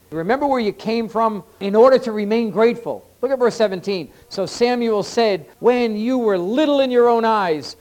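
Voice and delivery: high, loud, ringing scripture proclamation; full-force preaching. Real, not acted. Preacher reads Samuel's rebuke of Saul to the congregation, full-force preaching. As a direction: preach it out loud, driving the questions home.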